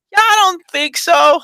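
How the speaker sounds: high, drawn-out skeptical reply; retold doubt. Answering Amanda's hopeful theory, exaggerated doubtful reply. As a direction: stretch the doubt, loud and high.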